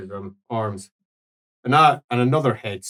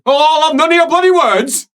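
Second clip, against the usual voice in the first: laughing roar; 14 semitones higher, 6 dB louder than usual. Acted. Old priest roars, laughing.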